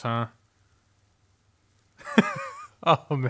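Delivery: ordinary talk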